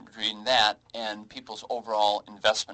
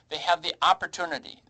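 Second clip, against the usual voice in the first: "opportunity" lifted higher with firm emphasis; stress on opportunity; 6 semitones higher, 4 dB louder, faster than usual. Real, not acted. Financial adviser urging people to plan, stressing "opportunity". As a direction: lean on the word "opportunity" to underline it. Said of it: "I emphasize the word opportunity".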